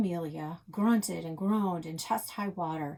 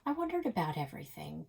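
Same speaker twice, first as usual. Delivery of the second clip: plain reading voice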